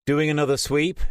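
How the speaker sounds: light friendly question from Jason; Jason's voice. A narrator's reading.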